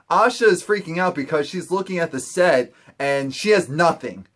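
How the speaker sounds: normal talking voice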